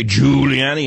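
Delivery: "Giuliani" with a loud, drawn-out first syllable; stress on first syllable. Retold or imitated. Host mimicking a demagogue working a crowd, first syllable of "Giuliani" punched. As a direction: blast the "Giu" of "Giuliani", then let the rest drop. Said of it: "with an emphasis on the first syllable".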